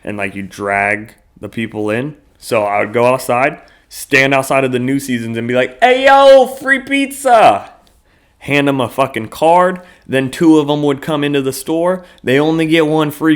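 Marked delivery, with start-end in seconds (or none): 5.8-7.7 calling out. Retold or imitated. Calling out to shoppers outside store.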